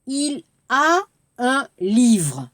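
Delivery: slowly spelt out "il a un livre."; slow model sentence. Shown as a demonstration. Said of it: "par exemple".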